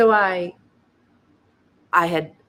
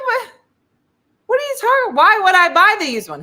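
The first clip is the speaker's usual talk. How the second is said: high, loud mock-outraged protest; hammed-up disbelief at a salesman; incredulous outburst; 12 semitones higher, 11 dB louder than usual. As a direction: exclaim it way up high, utterly baffled. Acted outraged protest to car salesman.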